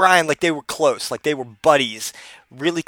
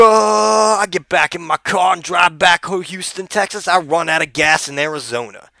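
Booker T impression; 2 semitones higher than usual. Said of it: "You got any more stories".

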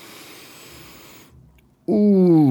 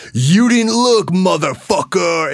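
slow, higher, louder comeback; mock angry retort; 7 semitones higher, 4 dB louder, slower than usual. To the old man after skidding at the light, a slow raised comeback. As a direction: snap back slowly with a raised voice. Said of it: "instantaneously responded".

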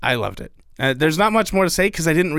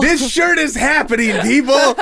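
high, loud, laughing announcement; excited proclamation; 11 semitones higher, 5 dB louder than usual. Real, not acted. Cohost declares the joke T-shirt is happening, loud excited proclamation. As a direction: declare it like a triumphant rallying cry.

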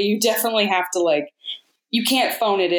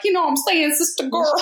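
high, hammed-up patronising director voice; 8 semitones higher than usual. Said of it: "call somebody, like".

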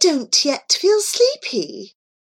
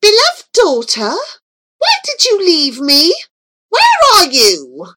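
higher and louder, calling across the garden; 10 semitones higher, 7 dB louder than usual. A narrator's reading. Queen calling for Joy from the palace, loudly. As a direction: summon her daughter from afar. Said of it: "the Queen's voice called to her".

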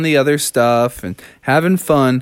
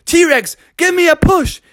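high-pitched shouted request; excited frog giving orders; urgent call; 3 dB louder than usual. Acted. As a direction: call to a friend mid-stunt. Frog calls for a push.

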